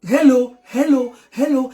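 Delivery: coaxing repeated hello; demo insisting. Shown as a demonstration. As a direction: insist on hello until he answers. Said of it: "quand tu parles".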